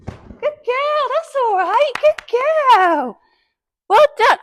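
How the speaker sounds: high, loud, delighted praise for the pony; thrilled coo of well done; gushing praise. Real, not acted. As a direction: squeal praise like she nailed it. Praising pony over raised poles.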